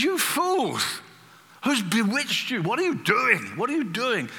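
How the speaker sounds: high, incredulous scolding outburst; angry rebuke. Retold or imitated. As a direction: rebuke them as fools, voice flying up high. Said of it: "it's his angriest letter in the whole Bible".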